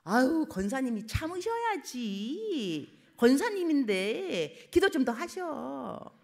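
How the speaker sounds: drawn-out, sanctimonious nudge; preachy advice. Retold or imitated. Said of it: "이렇게 말하면 안 돼요".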